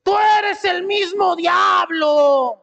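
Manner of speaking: long, high-pitched scream; screaming wife. Acted. Wife screaming at her husband, high drawn-out shout. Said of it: "la esposa le estaba gritando al esposo".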